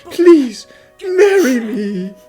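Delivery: loud, high please easing into a drawn-out proposal; impassioned plea. Acted. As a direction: plead from the heart, slowing down.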